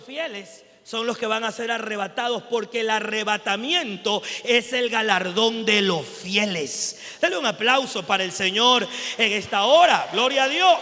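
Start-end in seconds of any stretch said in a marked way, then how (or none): 2.4-10.8 loud, forceful preaching; prédica enérgica. Acted. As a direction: build to a loud call for praise. (prédica a toda voz)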